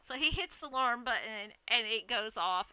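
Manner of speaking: usual speaking voice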